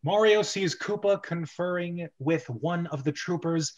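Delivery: usual speaking voice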